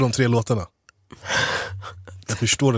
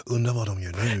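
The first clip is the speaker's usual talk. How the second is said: low, soft thinking aloud; quiet wondering; 4 semitones lower, 7 dB quieter than usual. Retold or imitated. Stay-at-home host wondering about friends, quietly pensive. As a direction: murmur it to yourself, lower and softer. Acts out lonely thoughts at home.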